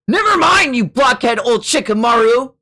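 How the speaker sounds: high, loud exasperated yell; angry outburst. Acted. Youngster insults old Shikamaru, angry high yell. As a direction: hurl the insult at full pitch.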